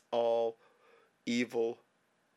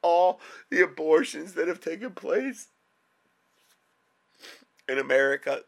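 crying. Real, not acted. Sobbing prayer about dying children.

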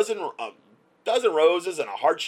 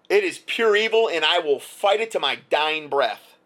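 indignant outburst; 5 semitones higher, 5 dB louder than usual. Real, not acted. Furious defiant rant.

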